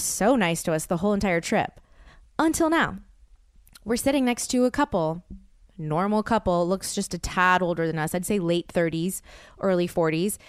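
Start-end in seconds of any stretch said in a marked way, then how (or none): none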